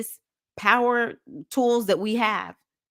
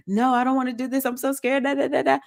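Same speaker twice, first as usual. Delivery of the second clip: mock scared shadow; 6 semitones higher, faster than usual. Voicing her scared shadow.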